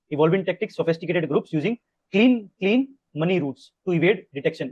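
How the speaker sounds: very slow, word by word, for notes; slow note-dictating read; slow dictation. Shown as a demonstration. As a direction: dictate so students can write.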